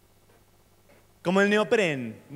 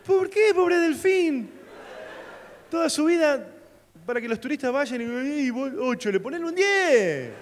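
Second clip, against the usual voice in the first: mock outrage; 6 semitones higher, slower than usual. Defiende al delfín, indignación burlona.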